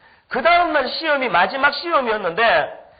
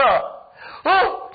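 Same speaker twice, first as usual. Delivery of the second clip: mock surprise; 7 semitones higher than usual. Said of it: "이게 능력받는 소리였더라고요".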